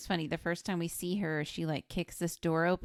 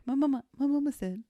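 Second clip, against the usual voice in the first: higher, louder simple-minded stammer; imitating a sheltered boy repeating what his mama said; Water Boy impression; 8 semitones higher, 6 dB louder, faster than usual. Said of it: "Yeah, Water Boy".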